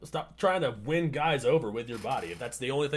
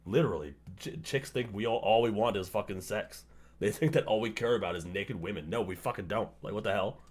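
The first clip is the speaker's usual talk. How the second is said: ordinary talk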